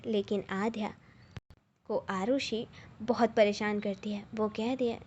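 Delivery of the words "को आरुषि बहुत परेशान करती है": usual speaking voice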